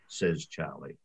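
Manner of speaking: usual speaking voice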